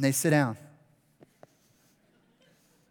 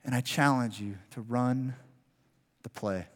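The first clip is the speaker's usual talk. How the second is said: quiet earnest challenge; 5 semitones lower, 7 dB quieter than usual. Real, not acted. Closing challenge of sermon.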